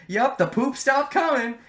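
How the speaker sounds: high, loud, slow offhand quip; casual Nagito. Retold or imitated. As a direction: toss it off breezily, in a put-on voice. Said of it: "he said it so casually".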